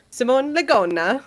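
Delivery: name said with an Italian accent. Shown as a demonstration. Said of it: "Like an Italian accent?".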